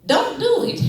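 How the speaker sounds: sudden high, loud punchline; grandma's blunt warning. Retold or imitated. Grandma warning her grandson off love, sudden high blurt.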